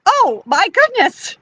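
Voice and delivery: high, drawn-out shock; startled alarm. Acted. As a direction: react taken aback, pitch jumping up. Woman startled by owl's hoot.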